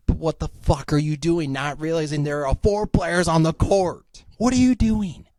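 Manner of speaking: high-pitched yelled rant; aggressive outburst. Real, not acted. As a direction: yell in exasperated disbelief, pitch climbing. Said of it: "that was a bit aggressive".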